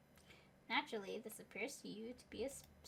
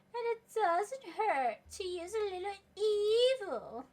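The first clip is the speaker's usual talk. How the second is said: loud, slow, higher villainous boom; evil villain line; 11 semitones higher, 11 dB louder, slower than usual. Acted. Narrator booming the evil twist, hammed-up villain. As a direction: ham up the evil like a cartoon villain. Dramatic villain-style punchline.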